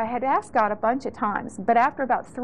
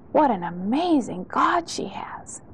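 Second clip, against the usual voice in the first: breathy hushed whisper of amazement; awed whisper; 3 semitones higher than usual. Retold or imitated. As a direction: whisper it like a marvelling onlooker. Awed quote from onlookers.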